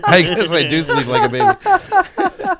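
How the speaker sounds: slow, high and laughing through it; giggling punchline. Real, not acted. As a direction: crack up at your own joke. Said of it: "laughing at own joke".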